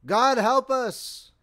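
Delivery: loud, high, slow bellow. A narrator's reading. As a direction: bellow it across the trench. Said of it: "he bellowed to the man next to him".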